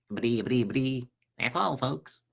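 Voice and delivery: half-hearted cartoon stutter, tossed off quietly; cartoon sign-off impression. Host retrying a cartoon ending under their breath, stuttering. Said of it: "Mel Blanc did all those voices".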